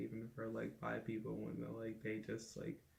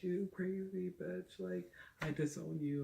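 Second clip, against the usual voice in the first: higher, slow mock outrage; imagined angry mom; 7 semitones higher, 4 dB louder than usual. Imagining mom rejecting her coming out, mock angry mom. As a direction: play the feared mom lashing out, a bit hammed.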